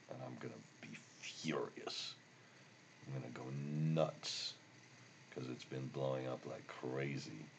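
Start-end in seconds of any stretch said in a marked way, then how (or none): 0.1-4.5 whispered aside. Real, not acted. Aside about Excel crashing.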